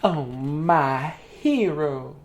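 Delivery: very slow swoon; swooning Piggy. Acted. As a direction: melt on the word hero.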